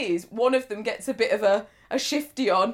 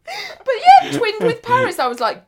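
usual speaking voice

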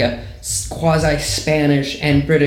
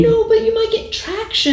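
high-pitched put-on comic voice; Craig Ferguson. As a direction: push it way up into a squeaky impersonation. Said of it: "I turned into Craig Ferguson".

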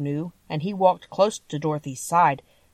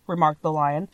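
usual speaking voice

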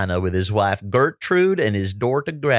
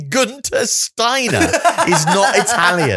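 laughing disbelief; 11 semitones higher, 5 dB louder, slower than usual. Real, not acted. Amused disbelief at cohost's claim.

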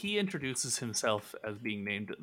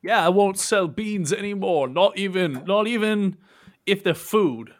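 loud, raised voice, anxious to please; nervous Horace; 6 semitones higher, 7 dB louder than usual. Acted. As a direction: promise like a flustered oaf under scrutiny. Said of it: "Horace, you're nervous".

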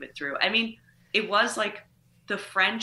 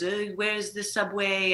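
put-on light French accent. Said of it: "And he goes".

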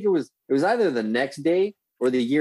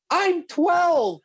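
put-on much higher little-girl voice; young girl. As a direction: squeak it like a kid. Girl answers the drunk buddy.

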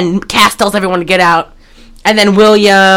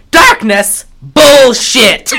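retold retort building to a loud, higher yell; mock defiant shout; 5 semitones higher, 3 dB louder, much slower than usual. Host as possessed woman answering the exorcist, mock defiant shout. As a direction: throw back the threat, mock-outraged.

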